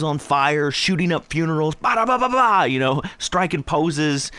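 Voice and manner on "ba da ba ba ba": high mock machine-gun burst; imitated gunfire. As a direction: rattle off pretend gunshots. Imitates shooting while listing rap antics.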